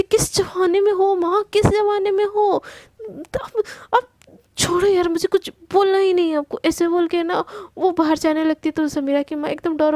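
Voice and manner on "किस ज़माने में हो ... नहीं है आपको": higher-pitched, exasperated scolding; angry. A narrator's reading. Samira scolds her mother for outdated thinking, angry and exasperated. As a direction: scold with raised pitch, then give up in exasperation. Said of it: "Samira एकदम गुस्से में बोलती".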